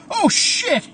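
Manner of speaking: high, loud panicked cry; startled yelp. A narrator's reading. Andy finds Caleb at the door, high startled yelp. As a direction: yelp it out in alarm.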